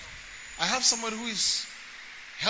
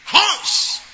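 dramatic exclamation; 10 semitones higher, 11 dB louder than usual. Acted. Preacher exclaiming about beast's horns.

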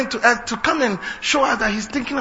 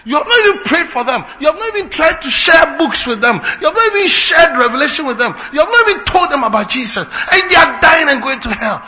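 shouted rebuke; 6 semitones higher, 7 dB louder than usual. Acted. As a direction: preach it loud and higher, hammering each line. Preacher rebukes congregation, rising to shouting.

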